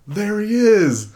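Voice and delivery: high, slow, loud shout; mock call out from a chasing crowd; excited call out. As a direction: yell it as if spotting your target across the street. Enemy spotting him in retold fight.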